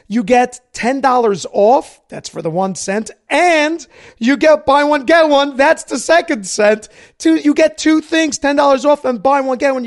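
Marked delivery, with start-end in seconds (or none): 3.3-9.8 excited pitch. Acted.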